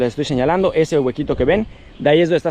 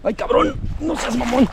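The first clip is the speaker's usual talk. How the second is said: yelping from the shock of the cold; sharp high cries in freezing water; in ice cold water; 8 semitones higher, slower than usual. Real, not acted. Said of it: "Uy, está helada el agua".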